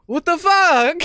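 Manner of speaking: high, loud mock outrage; hammed-up high-pitched yell. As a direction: imitate a bystander yelling in disbelief. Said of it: "just started yelling at him".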